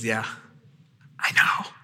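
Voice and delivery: quiet, calm, knowing; calm Jesus. Acted. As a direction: answer calmly, as if it was obvious. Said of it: "just calmly looks at them and says".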